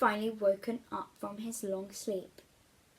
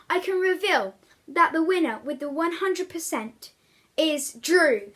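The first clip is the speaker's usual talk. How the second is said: louder announcer-style teacher reveal; teacher announcing; 4 semitones higher, 7 dB louder than usual. Acted. Science teacher to the class, a loud grand announcement. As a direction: build up to the winner's name.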